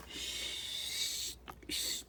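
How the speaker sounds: hushed whisper, a bit embarrassed. Real, not acted. Crafter to viewers reading a cheeky stamp, whispered. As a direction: whisper the cheeky words so nobody hears.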